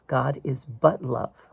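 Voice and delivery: slow, with "but" leaned on and falling; "God is but love" said slowly, weight on "but"; stress on but. Shown as a demonstration. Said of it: "The but is, is the important word".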